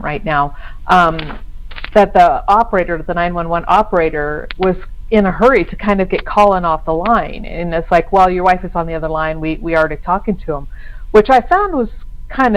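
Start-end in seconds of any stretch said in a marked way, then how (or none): none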